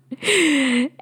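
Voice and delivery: real, high giggle at herself. Real, not acted. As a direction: giggle at your own confession. Laughs at own confession.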